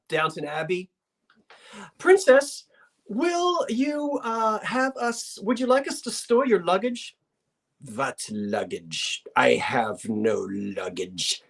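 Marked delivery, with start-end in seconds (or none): 2.0-7.2 high-pitched, halting polite servant; mock-fussy request in a high voice; solicitous servant. Acts out servant addressing the princess. 7.9-11.4 put-on low, very slow Russian accent; haughty Russian princess, deep and deliberate; fierce Russian princess. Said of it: "Princess Karagan. She was fierce".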